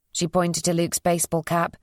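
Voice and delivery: ordinary delivery, nothing marked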